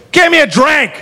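put-on high-pitched drunken demand; mock drunk demand. Drunk woman to the whole bar, mock demanding. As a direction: imitate a hammered woman demanding service. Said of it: "she's hammered".

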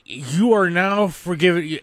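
ordinary delivery

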